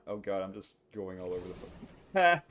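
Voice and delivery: ordinary delivery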